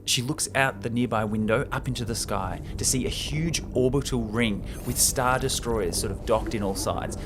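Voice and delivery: normal talking voice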